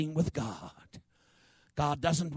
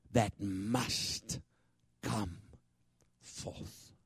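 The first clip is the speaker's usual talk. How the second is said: ordinary talk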